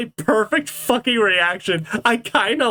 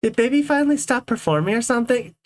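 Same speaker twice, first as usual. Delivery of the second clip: ordinary talk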